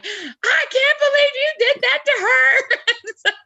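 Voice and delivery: very high, loud, rushed indignation; mock outrage. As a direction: shriek it in hammed-up outrage, close to laughing. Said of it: "But Lori was fired up".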